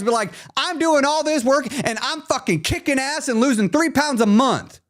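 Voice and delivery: high, drawn-out sassy scold. Real, not acted. Scolding a viewer who downplays her progress, high sassy voice. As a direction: call her out, big-sister sass.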